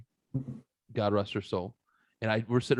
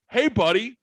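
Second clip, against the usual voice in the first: mock friendly greeting; 9 semitones higher, 4 dB louder than usual. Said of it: "Carter comes around the corner and goes".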